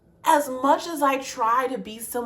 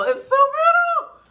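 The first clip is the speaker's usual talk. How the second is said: so brutal pitched way up, slow and strained; anguished cry; 4 dB louder than usual. Real, not acted. Reacting to a heartbreaking lyric, strained high cry. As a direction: let it break high on brutal. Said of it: "repeated with rising feeling".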